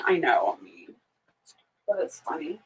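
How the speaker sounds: soft whispered aside; whispered confession. Real, not acted. Admitting to viewers she's mean, whispered. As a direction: whisper it like a guilty secret.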